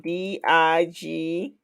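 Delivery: ordinary talk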